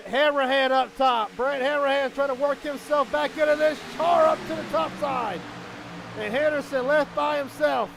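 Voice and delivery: urgent race announcer calling every move; excited race play-by-play. Real, not acted. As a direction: drive the commentary up to shouted peaks.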